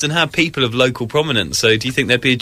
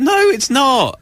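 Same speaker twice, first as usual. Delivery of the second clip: indignant no; 9 semitones higher, slower than usual. Real, not acted. Refusing to take coat off.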